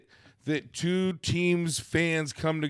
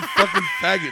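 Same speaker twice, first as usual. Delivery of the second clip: laughing. Real, not acted. Laughing while teasing friends.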